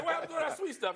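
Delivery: high, indignant squeal; mock outrage. Rookie protesting after being bull-rushed, high. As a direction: squeak it out, betrayed. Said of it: "I was just like".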